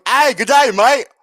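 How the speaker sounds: loud, high mock Australian greeting; hammed-up Australian accent, shouted cheerily.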